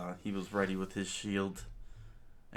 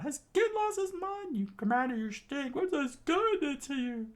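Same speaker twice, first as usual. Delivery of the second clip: very high, louder cartoon crew voice; high squealing pirates; 4 dB louder than usual. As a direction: screech it like outraged pirates. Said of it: "the pirates screamed out".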